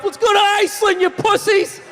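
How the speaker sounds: high, mock rallying shout; mock holler to fellow swimmers; rowdy call-out. Swimmers to Europe shouting to others.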